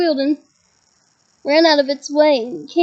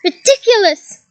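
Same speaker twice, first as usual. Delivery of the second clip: yelled spell; 5 semitones higher, 4 dB louder than usual. A narrator's reading. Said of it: "yelled Dean".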